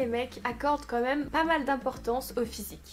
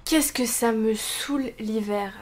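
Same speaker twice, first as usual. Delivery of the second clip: usual speaking voice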